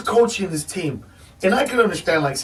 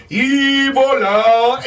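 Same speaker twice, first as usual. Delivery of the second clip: drawn-out magic word; 3 semitones higher, 4 dB louder, much slower than usual. Pretend. Mocks cure as a magic word.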